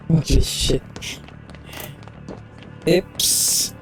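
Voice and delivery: normal talking voice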